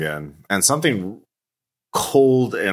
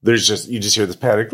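ordinary talk, nothing marked

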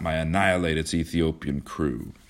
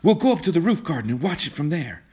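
high, eager urging; rally him in a raised pitch; urgent, high-voiced invitation; excited cry; 9 semitones higher than usual. A narrator's reading. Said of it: "she cried to him".

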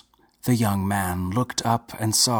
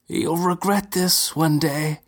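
plain reading voice

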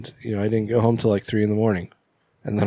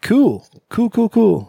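high mock cool; 11 semitones higher, 5 dB louder, slower than usual. Mock reaction to a joke.

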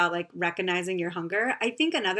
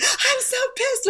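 mock angry whine; slower than usual. Quoting Dana's annoyance, very high.

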